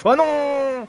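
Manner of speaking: rising excitement over the overtake; excited commentary. Real, not acted. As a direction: call the pass with a jump in energy. Live race call, overtake and crash. (commentateur excité)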